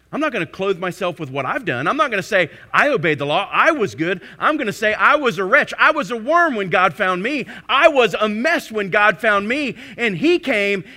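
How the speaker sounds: loud confessional sermon cry; preaching at full force. Acted. Preacher voicing a believer's confession before God, loud. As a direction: cry it out, owning the wretch you were.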